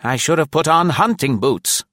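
put-on high, loud old woman complaining; elderly lady grumble, high and loud; grumbling old lady. A narrator's reading. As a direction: fuss about her shoes. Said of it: "she grumbled".

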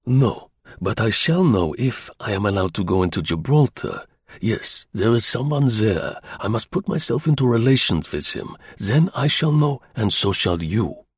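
put-on deliberate German-accented English; German agent, insistent. German agent angling for entry to Gibraltar, accented and insistent. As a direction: press the case in German accent.

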